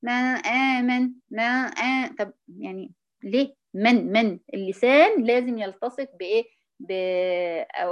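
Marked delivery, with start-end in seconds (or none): 0.0-2.1 "ما آمن" slow, with the tongue never reaching the gum; wrong demo: slow "ما آمن" with the noon unclosed; tongue off the ridge. Shown as a demonstration. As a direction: stretch "ما آمن" slowly without closing the noon. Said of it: "تقول لك إيه".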